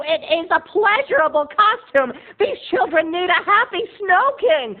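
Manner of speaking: shrill boss screaming at her employee; angry costume designer yelling at the Snow King; screamed scolding. Retold or imitated. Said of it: "she screamed out of nowhere".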